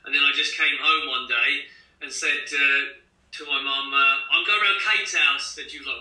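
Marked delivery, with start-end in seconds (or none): 4.3-5.6 higher-pitched, put-on local English accent; newly acquired English accent. Child coming home to mum with a changed accent, newly English. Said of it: "my accent completely changed".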